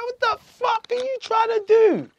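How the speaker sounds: high, drawn-out indignant cry; exasperated outburst. Retold or imitated. Man confronting the controlling policeman, high exasperated cry. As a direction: retell it as a high-pitched, stretched protest.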